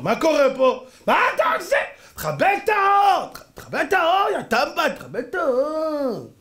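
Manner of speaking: high, indignant mock yell; angry shouting. Audience yelling at whoever lit up the cinema, high shouts. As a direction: stretch the yell out like an outraged cinema crowd. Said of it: "למה הם צועקים?".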